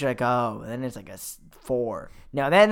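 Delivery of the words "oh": "Oh" lower and softer, deflated; mild letdown. Acted. Visitor twenty minutes into the museum, mildly deflated. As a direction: let the oh sink with fading interest.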